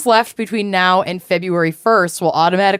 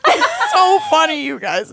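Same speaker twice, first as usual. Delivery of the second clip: laughing; 7 semitones higher than usual. Real, not acted. Laughing at trolls.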